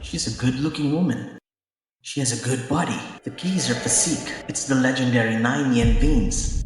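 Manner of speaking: soft, hushed appraisal; quietly sizing her up to himself; muttered aside. Acted. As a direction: keep it under your breath. Muttered aside assessing the woman.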